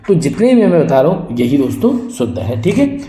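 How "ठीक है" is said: ordinary talk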